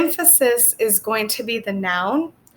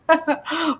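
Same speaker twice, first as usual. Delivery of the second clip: 1 short laugh; 6 semitones higher than usual. Real, not acted. Laughs at own joke.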